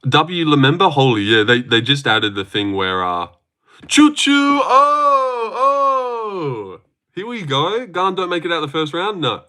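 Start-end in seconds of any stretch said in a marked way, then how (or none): 3.9-6.8 hammed-up train whistle into a whine; silly high train noise; mock train call. Mocking a new sound.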